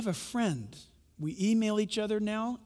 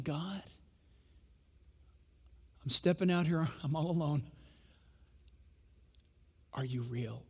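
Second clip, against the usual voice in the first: soft, lower, lonely plea; quiet prayer; 3 semitones lower, 7 dB quieter than usual. Retold or imitated. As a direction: whisper it to God alone. Voicing honest heart's prayer.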